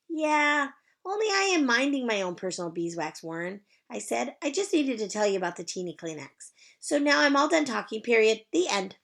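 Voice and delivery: put-on high, bright child's greeting; chirpy little girl. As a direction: sing it out like a cheerful kindergartner. Junie B. character voice.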